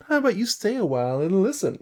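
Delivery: high, quick bard voice; high-pitched, showy bard; playful bard invitation. Acted. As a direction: toss out a brisk, lilting invitation. Voicing the bard's line, first take.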